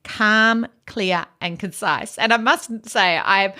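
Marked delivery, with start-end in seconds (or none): none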